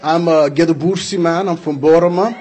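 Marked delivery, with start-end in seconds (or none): none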